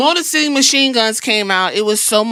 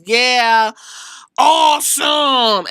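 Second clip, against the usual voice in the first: drawn-out imitated wrestler's shout; catchphrase call-out; 2 semitones higher, 3 dB louder, much slower than usual. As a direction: belt it out like a ring catchphrase.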